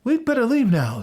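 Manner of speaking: put-on high-pitched boy's voice; Hansel. A narrator's reading. Hansel to Gretel about leaving, high boyish voice. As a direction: urge leaving in a high child's voice. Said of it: "said Hansel".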